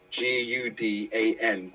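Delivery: G U D A N spelled slowly, letter by letter. Shown as a demonstration. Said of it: "which is from Gudan".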